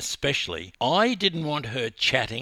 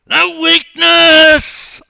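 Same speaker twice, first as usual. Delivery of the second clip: higher, much louder and slow scream; 20 dB louder, much slower than usual. Acted. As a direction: roar it at her on the blocks. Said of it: "I screamed".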